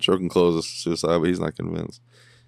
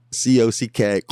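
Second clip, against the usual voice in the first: mock spelling it out letter by letter; playful spelled-out quote; 4 semitones higher, 6 dB louder than usual. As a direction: spell the rude word out, a bit louder. Spelling out a crude line.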